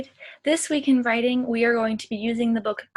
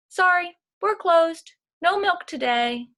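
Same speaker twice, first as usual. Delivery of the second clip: higher and louder, cheeky cow voice; cows' cheeky note; 6 semitones higher, 6 dB louder than usual. A narrator's reading. Cows posting a strike sign for Farmer Brown, perky and loud. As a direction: announce the strike sign brightly.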